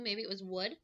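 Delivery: normal talking voice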